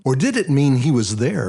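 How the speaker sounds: ordinary delivery, nothing marked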